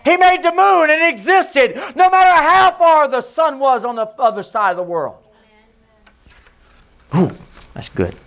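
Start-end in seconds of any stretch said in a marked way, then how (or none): 0.0-5.2 preaching. Acted. Preacher to congregation, building fervor. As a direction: build it up with conviction.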